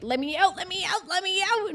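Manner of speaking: high, whiny pleas to be let out; mock pleading. Friend stuck behind a locked door, whiny begging. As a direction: play the trapped friend begging. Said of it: "she was like".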